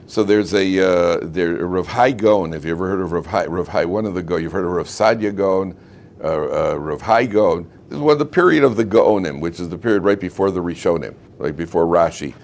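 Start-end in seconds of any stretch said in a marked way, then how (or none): none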